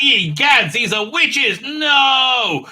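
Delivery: high, loud hammed-up alarm; mock horror, yelling; panicked shout. King seeing the mud people, hammed-up panicked yell.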